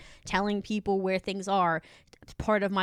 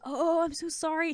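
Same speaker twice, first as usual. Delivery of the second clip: apologetic; 7 semitones higher than usual. Retold or imitated. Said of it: "She was so apologetic".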